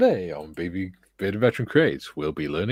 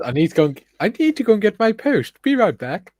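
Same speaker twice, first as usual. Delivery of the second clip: loud; 12 semitones higher, 6 dB louder than usual. Shown as a demonstration. Reading the chat to the co-host for the third time, louder and higher. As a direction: reread it much higher and louder, teasing.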